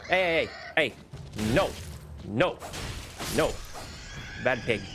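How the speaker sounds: sharp repeated hey, scolding the pig; scolding shout. Real, not acted. Yelling at a game pig.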